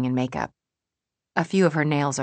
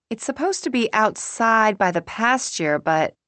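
higher voice fading into hesitation; trailing off; 7 semitones higher, 3 dB louder than usual. A narrator's reading. May explaining the plan, trailing off. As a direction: start raised, then let it tail away. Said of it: "May trails off".

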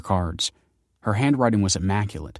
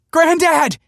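much higher, louder desperate yell; 9 dB louder than usual. A narrator's reading. Grandson yelling as his grandfather falls in, yelling. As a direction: scream his name in panic. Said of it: "he yelled".

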